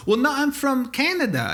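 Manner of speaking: put-on high-pitched French-Canadian accent; mock French accent, defensive correction. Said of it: "if you have a French accent".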